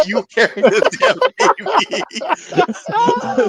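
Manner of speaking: fed-up demand dissolving into laughter; laughing exasperation. Retold or imitated. Fed up, handing over the TV.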